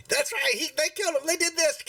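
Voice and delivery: put-on very high, excited child voice; little boy. Little boy cheers on the killing, high child voice. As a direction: pipe up high as a small boy. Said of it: "he's the little boy".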